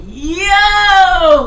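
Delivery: high loud shout; mock hyped yell; excited yell. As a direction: call out hyped. Geeked greeting to friends.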